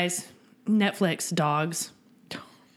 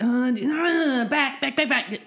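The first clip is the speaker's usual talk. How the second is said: higher, drawn-out groan into repeated cries; mock reaction; 5 semitones higher than usual. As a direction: act out a groaning, over-the-top reaction. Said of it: "it was a little bit like".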